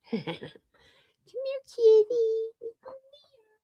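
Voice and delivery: high cooing call to a kitten. Real, not acted. Calling the new kitten.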